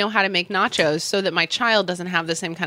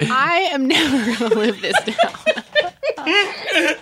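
slow, laughing through the words; half-groaning while cracking up; 8 semitones higher, much slower than usual. Real, not acted. Teased, laughs at herself.

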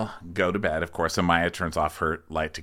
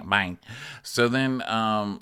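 ordinary talk, nothing marked

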